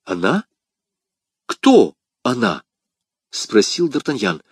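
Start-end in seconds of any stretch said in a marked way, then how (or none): 0.0-2.6 sharp, drawn-out demand to know; startled question. Acted. D'Artagnan demands to know who she is, startled. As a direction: pounce on the first word.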